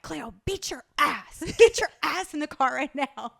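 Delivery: retold scolding. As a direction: order a child around, half laughing. Retold scolding, against the chill parent.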